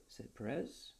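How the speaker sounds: usual speaking voice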